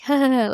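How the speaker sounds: short, light imitated chuckle; demonstrated laugh. Laughing off being called a brother, imitated awkward chuckle. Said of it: "I laugh, kind of like".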